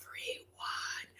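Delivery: eerie whispered ending. Retold or imitated. Sorceress ending her curse in a whisper. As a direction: breathe the last word as a threat. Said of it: "because she's like".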